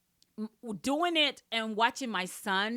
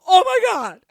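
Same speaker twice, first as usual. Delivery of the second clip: much higher and far louder, overjoyed; ecstatic oh my God; 10 semitones higher, 16 dB louder than usual. Retold or imitated. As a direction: shriek it in disbelief at the good news. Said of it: "I was like".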